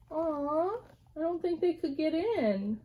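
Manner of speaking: mock disappointed aw. Teacher pretending the bunnies lost, mock disappointed. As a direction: sigh it to the kids like a letdown.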